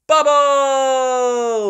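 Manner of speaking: high, loud sing-song title; title call. Acted. Host closing the story, bright title call.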